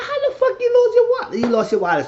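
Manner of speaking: very high, loud disbelief; incredulous outburst. Retold or imitated. Onlooker reacting to the lost wallet, incredulous. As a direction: squawk it in shocked disbelief.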